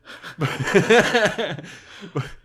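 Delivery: loud high burst of laughter; big laugh. Real, not acted. Host laughing at his Police Academy joke, loud laughter. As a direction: crack up out loud.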